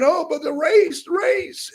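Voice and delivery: high, sing-song mock of their fixation; mocking whine. Mocking people who only see race, high and whiny. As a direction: squeak it out like you're making fun of them.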